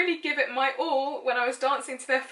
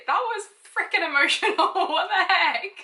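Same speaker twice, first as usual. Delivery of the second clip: laughing outburst; 7 semitones higher, 5 dB louder than usual. Real, not acted. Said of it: "frickin' emotional".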